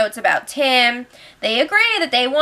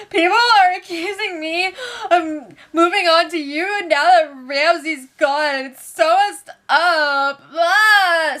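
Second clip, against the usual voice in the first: mock crying; 5 semitones higher than usual. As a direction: imitate her sobbing complaint, half laughing. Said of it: "Maddie was crying to Phil".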